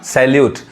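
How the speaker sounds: सैल्यूट as "sal-yoot", with a y glide; teacher shows the mistaken सैल्यूट with an open ae; common mispronunciation. Shown as a demonstration. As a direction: say सैल्यूट with "lyoot" at the end. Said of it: "गलत उच्चारण है".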